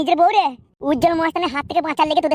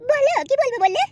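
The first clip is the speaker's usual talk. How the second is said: put-on much higher, slow girlish voice; coy young bride; 10 semitones higher than usual. Bride asking the matchmaker where to sit, high coy voice. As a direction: play the shy village girl.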